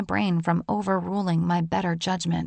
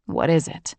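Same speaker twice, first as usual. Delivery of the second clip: lower, lightly stern man's voice; a touch sterner, a hint of a lowered man; stern John; 4 semitones lower than usual. A narrator's reading. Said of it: "his voice sterner than usual".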